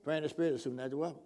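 quick, soft, low throwaway mumble; half-hearted recital. Shown as a demonstration. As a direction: rattle it off without any conviction. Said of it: "Not just, you know".

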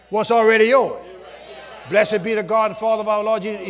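normal talking voice

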